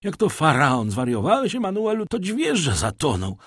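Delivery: high, agitated protest; incredulous outburst. A narrator's reading. Father thinks the messenger has gone mad, incredulous outburst. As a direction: challenge the news in astonishment. Father disbelieves the shouted news.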